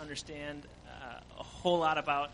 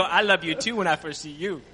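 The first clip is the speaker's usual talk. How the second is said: imitating her in a Creole accent, louder; the old woman turning to him and gushing, loud; affectionate old woman; 10 dB louder than usual. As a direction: gush it out loud like a doting grandmother.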